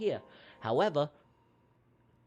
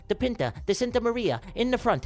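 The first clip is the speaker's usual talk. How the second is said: race play-by-play; 2 semitones higher, 5 dB louder than usual. Pretend. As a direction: lively race call, rising in bursts. Live duck race play-by-play.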